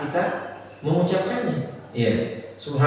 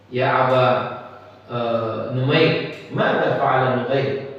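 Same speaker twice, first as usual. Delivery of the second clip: put-on tender to child; 5 semitones lower, slower than usual. Prophet tenderly asking a child.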